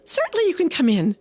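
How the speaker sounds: high, quick little mouse voice; a small mouse gladly welcomes the frog in; tiny mouse voice. Acted. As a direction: chirp it out fast like a tiny mouse.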